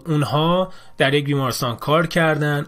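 ordinary talk

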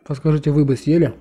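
chewing while talking; mouth full. Real, not acted. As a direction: food in mouth. Seen 3: dumpling put in mouth at -1, jaw chewing throughout.

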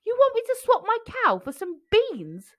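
high, disbelieving pea voice; Jack, incredulous. A narrator's reading. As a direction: squeak out the disbelief. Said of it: "said Jack".

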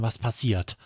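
usual speaking voice

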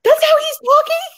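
hammed-up dismay at her own kids; mock panic. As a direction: play up the shocked parent.

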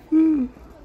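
big high yawn. Real, not acted. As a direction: let out a loud sleepy yawn. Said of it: "Ah, I'm yawning".

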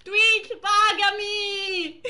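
high, loud whiny wail with a laugh in it; hammed-up sobbing demand for money; mock crying plea. Streamer begging Twitch to pay him, mock crying shout.

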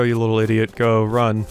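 ordinary talk, nothing marked

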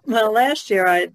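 ordinary talk, nothing marked

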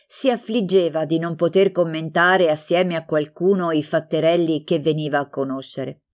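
usual speaking voice

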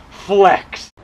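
normal talking voice

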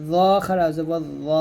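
"জ" made thick and heavy, vowel held long; thick, heavy consonant. Shown as a demonstration. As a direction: say "জ" full and rounded, stretching the final one. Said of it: "মোটা করে".

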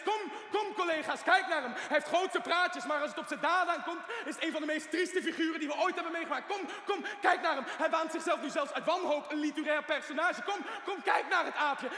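loud, high, sneering call to colleagues; mocking showman. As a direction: parade him like a freak show. Patient mocks doctors showing him off. (luid en spottend, als een spreekstalmeester)

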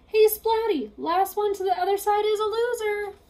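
high, loud taunting race call; cocky challenge. Acted. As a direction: dare him to race you. Spike challenges Splat to race.

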